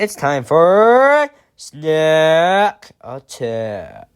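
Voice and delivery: slow, drawn-out announcer intro; news reporter voice. Shown as a demonstration. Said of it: "I sound like a news reporter when I say that".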